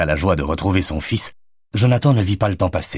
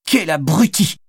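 a much higher, sharp exclamation of annoyance; irritated insult; 10 semitones higher, slower than usual. A narrator's reading. Madeleine insulting the man who collided with her, high and irritated. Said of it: "s'irrita Madeleine".

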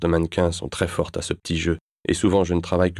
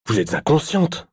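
much higher angry telling-off; scolding angrily; 10 semitones higher than usual. Acted. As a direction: chew her out. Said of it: "m'engueule-t-il". (il l'engueule)

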